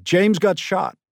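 high, loud cry of shock; crying news of a shooting to her mother. A narrator's reading. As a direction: blurt it out through tears. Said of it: "she was crying".

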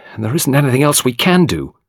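ordinary delivery, nothing marked

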